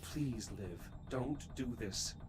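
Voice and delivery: desperate pleading. Acted. Pleading over dead crewmate.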